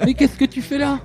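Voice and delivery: playful character voice. Pretend. S2 plays along, voicing a character.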